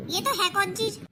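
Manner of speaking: usual speaking voice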